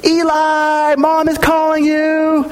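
put-on high, calling voice of an older boy down the hall; an older son shouting to his little brother from another room; big brother yelling. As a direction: call across the house, pitched high. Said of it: "in another room yelling".

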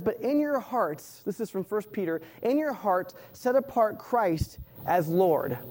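slow, deliberate reading of the verse; slow scripture reading. Shown as a demonstration. Preacher reads First Peter to the congregation, slow and deliberate. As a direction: let each word of the verse land.

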